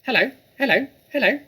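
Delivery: high squawky parrot imitating a human. Parrot greeting the children with a high squawk. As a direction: chirp it out like a talking bird. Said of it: "exactly like a human voice".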